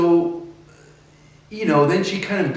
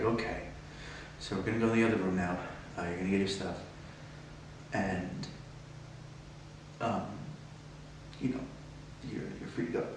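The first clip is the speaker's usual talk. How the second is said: low quiet voice; 5 semitones lower, 15 dB quieter, faster than usual. Retold or imitated. Retelling the guard's instructions.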